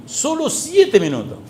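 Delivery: high, insistent plea to God; excited high-pitched plea; urgent plea. Retold or imitated. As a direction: press the Lord, high and urgent. Pleading count to the Lord.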